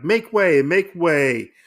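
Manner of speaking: mock crowd-clearing call, high start. People calling out in the street.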